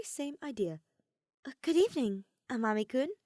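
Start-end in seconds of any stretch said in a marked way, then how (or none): 1.4-3.1 Kaede's voice. Acted. Kaede greets Amami in courtyard.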